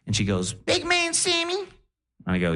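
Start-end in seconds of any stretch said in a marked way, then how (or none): 0.5-1.6 put-on very high, brash Def Jam comedy accent; grandma's crazy accent. Grandma on morphine greeting her grandson, high brash comic accent. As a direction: imitate a Cat Williams style accent. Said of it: "talking in a crazy accent".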